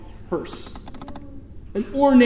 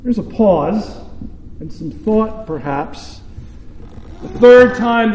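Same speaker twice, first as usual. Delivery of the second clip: ordinary delivery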